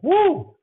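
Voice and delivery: sudden high whoop to shake off emotion; a loud high "woo" to reset the voice. Real, not acted. Clearing voice after choking up.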